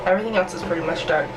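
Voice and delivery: ordinary delivery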